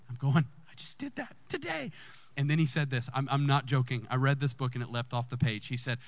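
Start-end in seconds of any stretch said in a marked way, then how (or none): 0.7-1.9 high, soft squeak of disbelief; small stunned squeal, played for laughs; mock astonishment. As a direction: squeak it quietly, comically amazed. Reenacts stunned recognition at book.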